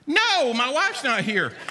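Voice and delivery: high, drawn-out mock protest; mock alarm. Preacher recalls a woman asking for a hug, mock alarm. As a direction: stretch it out as a comic refusal.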